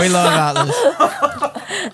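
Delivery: "We love Atlas" squealed very high and slow; hammed-up high squeal. Repeats it in a high hammy voice.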